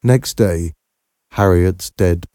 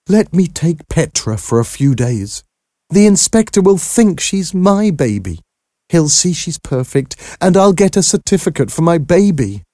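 high-pitched urgent pleading; desperate; 10 semitones higher than usual. A narrator's reading. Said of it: "hear the desperation in Harriet's voice".

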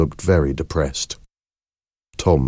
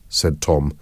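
usual speaking voice

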